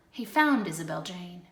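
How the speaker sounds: plain reading voice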